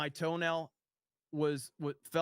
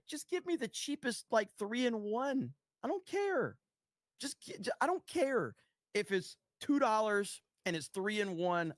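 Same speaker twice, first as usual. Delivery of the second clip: pitched way up, fed-up and emphatic; exasperated dude shopper; 5 semitones higher than usual. Retold or imitated. Mocking his own shopping self, high and fed up. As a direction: act out the guy who just wants cheap three-in-one. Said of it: "And I'm like".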